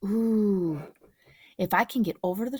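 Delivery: usual speaking voice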